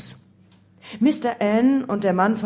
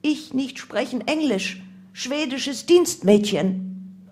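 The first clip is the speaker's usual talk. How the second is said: higher-pitched, halting maid act; feigned foreign housemaid; 6 semitones higher, slower than usual. A narrator's reading. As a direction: play the clueless Swedish servant. Narrator poses as foreign housemaid.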